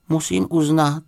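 high, measured bear voice; little bear remarking. A narrator's reading.

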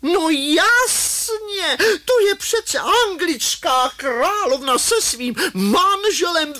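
very high, screechy excited cry; shrieking lady. A narrator's reading. Woman in the crowd recognises the queen, high shrieking cry. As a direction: shriek it out slowly at the top of the voice. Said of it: "nějaká paní zakřičí".

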